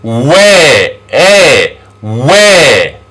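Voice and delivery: long open eh in Where; vowel demonstration. Shown as a demonstration. Teacher models two vowels together, Where with long eh. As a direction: stretch Where, isolate eh, repeat. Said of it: "So this sound is eh".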